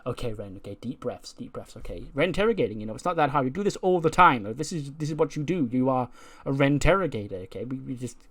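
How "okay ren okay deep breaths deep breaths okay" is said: quiet, nervous pep talk to herself; nervous self-talk. Retold or imitated. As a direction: mutter it to calm down. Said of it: "she's all nervous".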